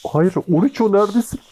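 high desperate call for friend; real panic, calling out high; panicked call. Real, not acted.

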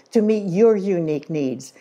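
ordinary delivery

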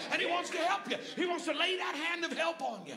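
high shouted proclamation; impassioned, high preacher shout; full-force preaching. Real, not acted. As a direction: cry it out to the congregation.

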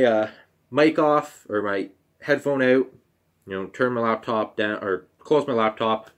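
normal talking voice

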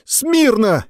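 shriller shouted command. A narrator's reading. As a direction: try harder, still squeaky. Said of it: "Не так, громче!".

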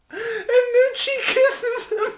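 slow, high, tearful laughter; squeezing the words out through helpless laughter; crying with laughter; laughing hard. Real, not acted. Laughing at cringey scene.

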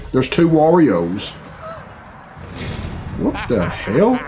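ordinary delivery

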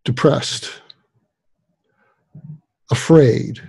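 one word at a time, long pauses, falling tone; listing words gently. Shown as a demonstration. Psychiatrist offering eight words to try on, gently listed. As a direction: lay each word down and let it sit. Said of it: "when you list the words".

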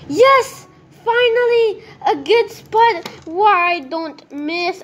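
high, loud and thrilled, slowing on the last words; delighted gamer cheer; excited relief. Real, not acted. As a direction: cheer at finally finding the spot. Finally gets a good spot.

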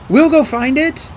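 high, slow, loud insistence; volunteer with urgent determination; eager resolve. A narrator's reading. Boy offers to seek the cure, eager.

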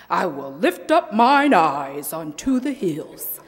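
booming preacher read of the verse; mock the big preacher style; preacher imitation. Youth speaker mimics a reverend's preaching. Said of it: "that's a little too much like Reverend J T".

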